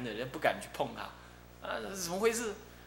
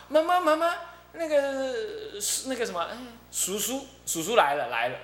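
put-on excited little girl; 6 semitones higher than usual. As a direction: call your mother, all excited. Little girl calls her mother.

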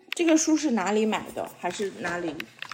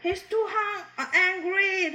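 lightly voiced, higher-pitched angry character; a hint of a cross character from the book; storybook character voice; 8 semitones higher, slower than usual. Mother reading a picture book to her son, mock angry character voice. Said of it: "他说".

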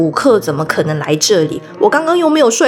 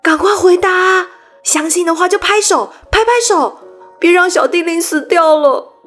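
higher, louder rallying call; 7 semitones higher than usual. Acted. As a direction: call out to every child. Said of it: "大声地对所有梦见永无岛的孩子们说话".

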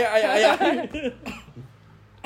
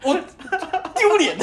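laughing tease; 10 semitones higher than usual. Real, not acted. Teasing friend, laughing.

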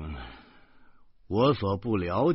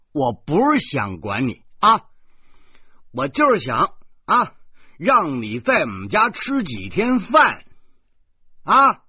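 higher and louder, wheedling and placating; coaxing; 8 semitones higher, 7 dB louder than usual. Acted. Guardian coaxing the girl off the railing, placating. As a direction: talk her down, eager to soothe.

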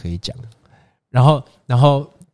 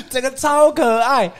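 gushing laugh; 13 semitones higher, 4 dB louder, slower than usual. Real, not acted.

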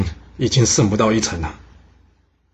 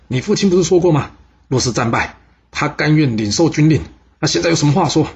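higher, louder furious rebuke; the king berates the general's son; angry scolding; 5 semitones higher, 3 dB louder than usual. Acted. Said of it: "他气得大骂说". (楚王对陈大兴愤怒大骂)

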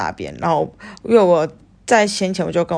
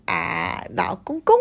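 ordinary talk, nothing marked

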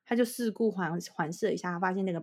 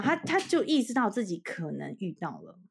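ordinary talk, nothing marked

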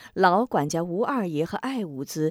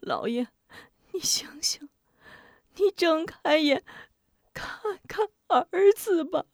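tearful pleading; 9 semitones higher than usual. Acted. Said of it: "接过孩子".

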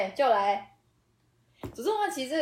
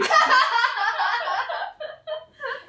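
burst of laughter; 6 dB louder than usual. Real, not acted. Laughing at own boast.

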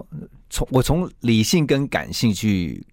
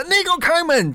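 much higher, furious mock shout; retold furious demand to open up; angry yell. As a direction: bark it at the locked door. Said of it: "我就真的很生气".

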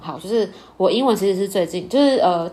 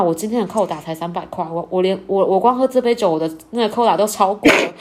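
ordinary delivery

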